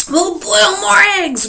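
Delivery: high, slow, loud roar. Acted. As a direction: bellow it at his wife. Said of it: "roared Mister Wagon Wheel".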